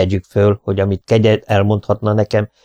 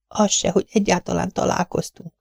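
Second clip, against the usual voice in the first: high and much softer, hushed; whispering; 11 semitones higher, 5 dB quieter than usual. A narrator's reading. Mrs Tenius begging her friend to hide her, whispering. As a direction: plead in a hurried whisper. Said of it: "suttogta Mrs Tenius".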